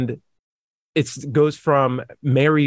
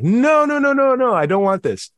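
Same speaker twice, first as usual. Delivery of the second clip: mock wail; 10 semitones higher than usual. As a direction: wail your refusal like a panicked girl. High, loud mock wail of Mary.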